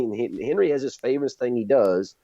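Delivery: ordinary talk, nothing marked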